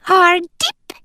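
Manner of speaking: high squeaky little animal voice; squeak. A narrator's reading. A mongoose greeting the humans in the cave, high squeak. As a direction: squeak it like a tiny furry creature. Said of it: "he squeaked".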